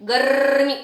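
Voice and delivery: गर्मी as "garrr-mee"; correct rolled r. Shown as a demonstration. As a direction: roll the r long in गर्मी, no vowel after it. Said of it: "उच्चारण होता है".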